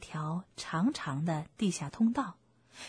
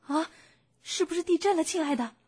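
higher-pitched alarmed fox wife; Mrs. Fox calling out; 8 semitones higher, faster than usual. Acted. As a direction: ask anxiously in a raised mother-fox voice. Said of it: "狐狸太太大声地问道". (狐狸太太高声发问)

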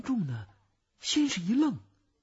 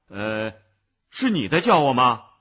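洪亮地向远处发问; 先迟疑一声，再大声问; 银幕人物大唐; 12 dB louder than usual. Acted. 大唐指着座位发问. (用厚实响亮的声音询问)

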